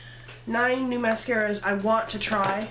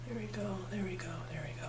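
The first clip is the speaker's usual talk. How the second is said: soft murmur; 3 semitones lower, 12 dB quieter, faster than usual. Real, not acted. Admiring her own makeup.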